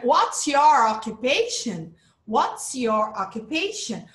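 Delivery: slow question, "What's" punched at the start; deliberate model of "What's your occupation", front-loaded; stress at the beginning. Shown as a demonstration. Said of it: "A intonation vai no comecinho".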